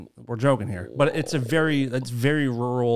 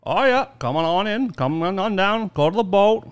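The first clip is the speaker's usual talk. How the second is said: higher, louder Maine fisherman; Maine accent; 7 semitones higher, 4 dB louder than usual. Shown as a demonstration. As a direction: call out directions in a broad Maine accent. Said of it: "It's very weird. It's like a".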